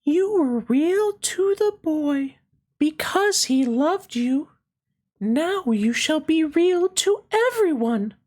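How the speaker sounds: very high, gentle fairy voice. Acted. Fairy greeting the little rabbit, high and gentle. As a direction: sing it out light and high like a fairy.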